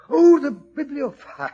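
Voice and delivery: the penny drops out loud; sudden realization. Acted. Watson realizing the bibliophile has come to his house, surprised.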